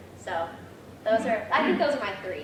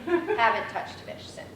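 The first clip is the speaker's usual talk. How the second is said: ordinary talk, nothing marked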